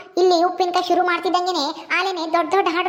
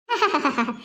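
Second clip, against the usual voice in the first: low, falling mocking chuckle; scheming laugh; 3 semitones lower, 3 dB quieter than usual. Sister-in-law alone after false good wishes, scheming laugh.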